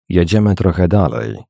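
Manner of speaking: ordinary delivery, nothing marked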